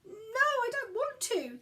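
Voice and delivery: high, slow, small frightened voice; a little boy whimpers his refusal, slow and high; whimpering little boy. A narrator's reading. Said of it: "Gideon whimpered".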